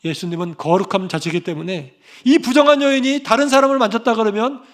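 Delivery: normal talking voice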